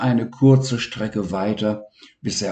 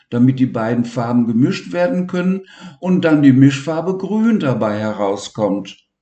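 plain reading voice

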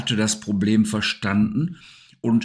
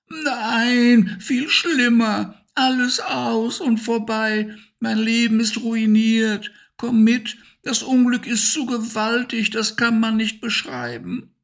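much higher, wailing hamster voice; despairing hamster; 13 semitones higher than usual. Acted. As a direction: lament as if your life is ruined. Despairing Harry laments. (viel höher, verzweifelt jammernd)

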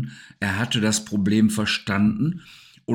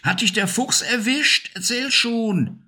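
much higher, anxious questions; worried; 13 semitones higher than usual. Acted. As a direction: urge him to tell you quickly. Worried Siggi presses Harry. (viel höher, besorgt drängend)

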